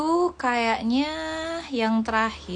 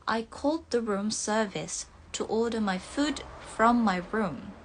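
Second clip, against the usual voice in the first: careful British English with silent r's; British accent. Shown as a demonstration. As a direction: read it the British way, dropping each r. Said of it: "kalau pengucapannya British". (logat British)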